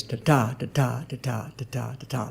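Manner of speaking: soft, low "da-DA" pulse fading away; light-heavy "da-da", over and over; iambic beat. Shown as a demonstration. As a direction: chant "da-da" with every second syllable stressed. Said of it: "That's an iamb".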